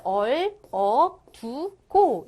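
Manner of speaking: 얼, 어, 두, 고 slow, with gaps; syllable-by-syllable reading. Shown as a demonstration. Teacher shows students how to read, 얼, 어, 두, 고 separated. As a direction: read 얼, 어, 두, 고 one syllable at a time. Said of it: "이렇게 읽으시면 되는 거야".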